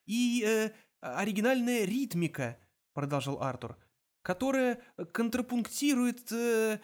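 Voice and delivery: high-pitched, groping for grand words; hesitant, gushing praise; faltering improvisation. A narrator's reading. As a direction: stretch words out while stalling. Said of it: "Он запнулся".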